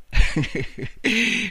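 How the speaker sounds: short high burst of cackling; choking laughter. A narrator's reading. Fallen man laughs helplessly at Marta, choking. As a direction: cackle until choked. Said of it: "soffocato dal riso".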